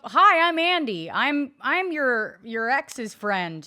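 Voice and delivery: loud, slow, overeager hello; hammed-up loud self-introduction across a noisy party; mock eager Andy. Friend mocking Andy's party intro, loud and overeager. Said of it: "But I didn't do it like that".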